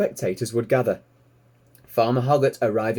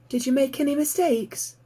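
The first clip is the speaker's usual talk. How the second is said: eager piglet. Acted. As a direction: squeak it out like a curious piglet. Piglet Babe asks.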